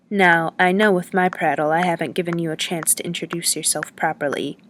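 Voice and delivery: ordinary delivery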